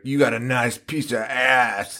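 slow, leering stepdad imitation; put-on creepy stepdad voice, slow; mock sleazy stepdad. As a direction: imitate a lecherous stepdad, drawing it out. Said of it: "he's like".

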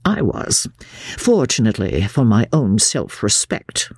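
ordinary delivery, nothing marked

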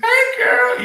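high, loud, excited holler; squealed high-pitched hail; calling out a greeting. Acted. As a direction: call out to a friend across the way. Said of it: "They're gonna be like".

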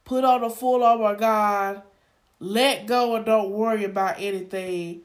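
ordinary delivery

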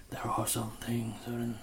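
very soft, hushed wizard imitation; ominous hush. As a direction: drop to a near-whisper, as if warning. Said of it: "And he's like".

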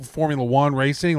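ordinary talk, nothing marked

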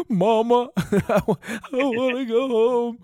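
high-pitched, drawn-out homesick whine; mock childlike whimper. Telling friends how he begged Zach, mock whimper. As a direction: whimper it like a scared child, milking it. Retold plea to a companion.

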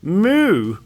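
put-on loud, high English-accented moo; high, booming mock English "moo"; English cow. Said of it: "the English cows what are like".

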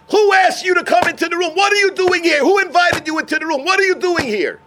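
sharp, loud, high scolding; angry rebuke. Retold or imitated. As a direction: tell him off at full volume. Baba Sali rebukes son-in-law.

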